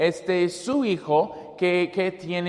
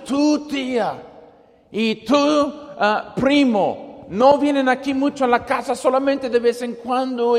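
raised, tense scolding; angry parent holding up the son; mock scolding; 6 semitones higher than usual. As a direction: scold the son for hitting his cousin.

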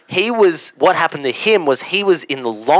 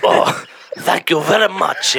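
pleased mock-Italian thanks; hammed-up Italian accent, pitched up; Italian man; 6 semitones higher, 5 dB louder than usual. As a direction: take the compliment in a broad Italian accent. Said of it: "best Italian accent you've ever done".

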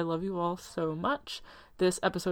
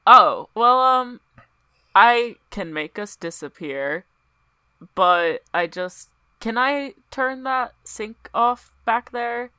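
flustered loud asking; 11 dB louder than usual. Acted. Player flustered, begging the flame to turn the sink off. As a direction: babble it out nervously.

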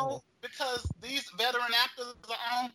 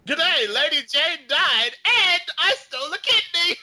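much louder, declaiming; hammed-up soap melodrama; 6 semitones higher, 9 dB louder than usual. Host mocking a soap character's big line. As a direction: proclaim it like a soap villain.

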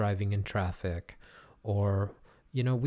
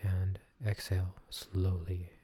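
soothing massage murmur; 4 dB quieter than usual. Acted. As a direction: guide them into calm, gently. Leads breathing meditation.

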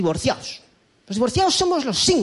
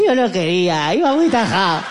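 mock crying drunk groom; 3 dB louder than usual. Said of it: "Yo iba a mi boda llorando".

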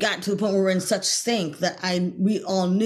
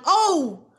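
realizing; 11 semitones higher, 4 dB louder than usual. Retold or imitated. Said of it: "I'm like".

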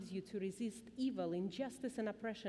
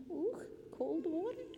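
high, cooing over cold water; 8 semitones higher than usual. Real, not acted. Baby touched with cold water.

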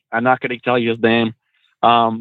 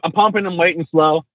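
ordinary talk, nothing marked

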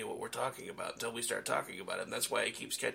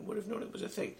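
ordinary talk

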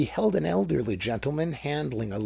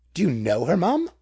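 demanding; 6 semitones higher, 7 dB louder than usual. A narrator's reading. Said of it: "demanded the policeman".

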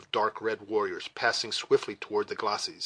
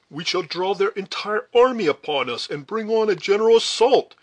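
raised, higher voice in warning; urgent officer's warning; 9 semitones higher, 6 dB louder than usual. A narrator's reading. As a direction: warn the party urgently, loud and high. Duncan warns in battle.

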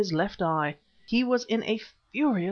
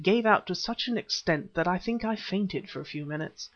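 ordinary delivery, nothing marked

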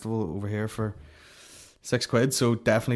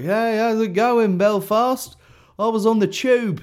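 much higher, louder, slow chirpy English tour-comic voice; mock English comic; 11 semitones higher, 8 dB louder, slower than usual. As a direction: ham up a cheery English comedian greeting the crowd. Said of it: "English asshole who's on tour who's like".

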